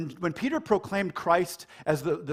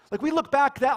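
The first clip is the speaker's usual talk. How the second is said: usual speaking voice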